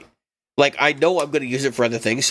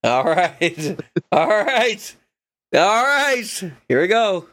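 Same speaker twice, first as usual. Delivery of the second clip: building excitement; 5 semitones higher, much slower than usual. Real, not acted. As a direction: hype it up, climbing. Rising excitement.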